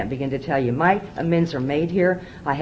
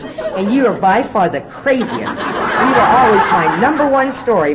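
slow and louder, breaking into laughter; can't get through his words without laughing; laughing through the quote; 5 semitones higher, 6 dB louder, slower than usual. Real, not acted. Quoting the Mountie to the AA crowd, laughing slowly.

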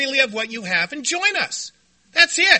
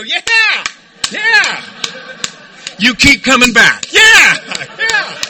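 rousing group cheer; 8 dB louder, slower than usual. Retold or imitated. Meeting answers an abusive newcomer, loud mock cheering. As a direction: cheer them on at full volume.

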